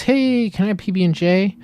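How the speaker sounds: put-on light kid voice; little girl asking. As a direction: ask like an eager child. Girl asks in pitched ad idea.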